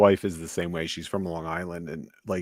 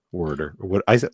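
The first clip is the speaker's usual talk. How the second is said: normal talking voice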